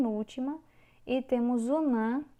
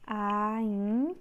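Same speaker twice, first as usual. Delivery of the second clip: "Aipim" split into AI, long pause, PIM; syllable by syllable. Shown as a demonstration. Teacher separating the next word, syllable by syllable. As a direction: hold AI, pause, then say PIM.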